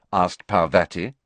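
ordinary delivery, nothing marked

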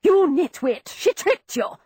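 shrill angry rebuke; furious scolding. A narrator's reading. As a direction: tear into the servant. Aunt scolding servant furiously.

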